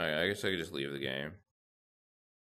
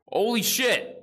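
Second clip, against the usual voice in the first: high, loud burst of surprise; excited shout; 8 dB louder, slower than usual. Real, not acted. Gamer shouting at his lucky Skee-Ball throw, thrilled. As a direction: yell it like you can't believe the shot. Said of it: "I'm getting too excited now".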